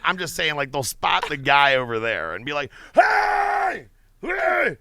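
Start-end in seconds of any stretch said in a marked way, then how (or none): 2.9-4.8 high, loud shout of a name across the room; retold shouting. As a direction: yell it like a crazed fan spotting a star. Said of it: "just scream the motherfucker's name".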